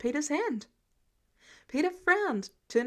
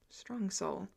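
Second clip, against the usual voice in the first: softer, lower, under the breath; quiet echo; 4 semitones lower, 9 dB quieter than usual. A narrator's reading. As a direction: repeat it to yourself, stunned. Peter echoing Thor's words.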